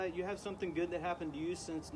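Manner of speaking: ordinary talk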